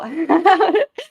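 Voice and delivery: high, loud laugh that cracks toward tears; a helpless laugh-sob; laughing cry. Real, not acted. Overwhelmed by comment, laugh-cry.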